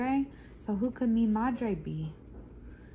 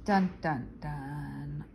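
dramatic doom; 7 semitones lower, slower than usual. Acted. Dramatic doom sound effect.

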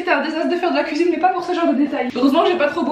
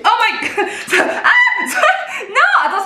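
high laughing cry of dismay; laughing shriek; 11 semitones higher, 4 dB louder, slower than usual. Real, not acted. Egg shell falls in bowl.

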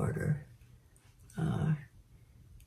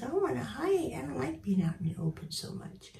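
high, then fast whispered bird voice; voicing the bird; 6 semitones higher, 6 dB quieter than usual. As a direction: speak for the shy bird in a timid whisper. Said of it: "She goes".

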